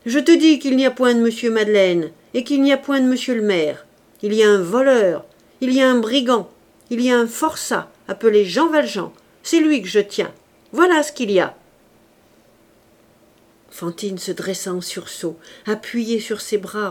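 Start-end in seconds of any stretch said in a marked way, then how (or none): 0.0-11.6 loud, hammering accusation; furious denunciation. A narrator's reading. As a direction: denounce the convict forcefully. Javert angrily denounces Valjean.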